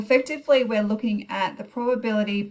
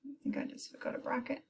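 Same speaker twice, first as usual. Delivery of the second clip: whispering; 5 semitones higher, 12 dB quieter, faster than usual. Real, not acted. Muttering to herself at calculator.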